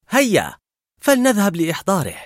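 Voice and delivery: high, eager call to action; rally a friend to come along; eager urging. A narrator's reading. Girl to Carl about fetching his balloon, eager high urging.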